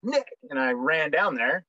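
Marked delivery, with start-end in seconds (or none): 0.0-0.3 higher, sudden oh crap; retold alarm. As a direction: catch the danger all at once. Realizing the interpreter is exposed.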